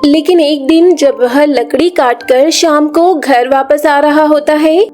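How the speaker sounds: ordinary delivery, nothing marked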